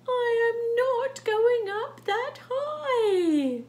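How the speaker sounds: high, loud, drawn-out refusal; reluctant cat. Acted. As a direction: wail it slowly as a stubborn cat.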